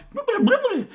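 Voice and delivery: Pokemon babble. Retold or imitated. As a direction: babble like a cartoon creature. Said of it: "It might go".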